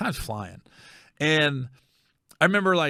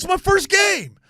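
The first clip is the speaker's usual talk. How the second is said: put-on high, loud childish whine; whiny plea; 6 dB louder, slower than usual. Fan pleading with his grandmother, high whine. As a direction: plead like a disappointed kid. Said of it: "he goes".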